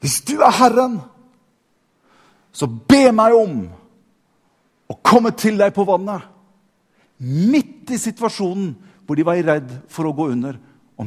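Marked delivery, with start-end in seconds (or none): 0.0-6.3 high, loud shout across the water; calling out. Acted. Peter shouts to Jesus from the boat, calling out. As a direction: call out boldly over a storm. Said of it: "så roper Peter".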